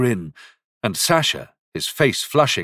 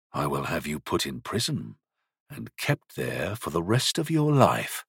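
low, quiet, calm threat; 4 semitones lower, 3 dB quieter than usual. A narrator's reading. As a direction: say it evenly, coolly menacing. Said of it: "He said this quite calmly".

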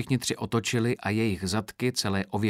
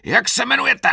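very high, loud sergeant's yell; roared; 10 dB louder than usual. A narrator's reading. Sergeant demanding the recruit's name, face-to-face roar. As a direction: yell the question right into his face. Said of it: "Pak zařval Pedrovi do obličeje".

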